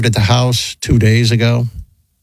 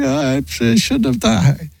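mock crying; 11 semitones higher than usual. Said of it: "Like, she was crying".

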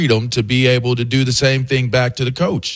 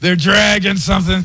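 mock shout; 7 semitones higher than usual. Mimicking dad line.

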